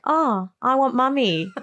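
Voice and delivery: put-on high, slow little-girl voice; lightly voiced sleepy toddler; sleepy child. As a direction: say it as a drowsy child. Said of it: "was like".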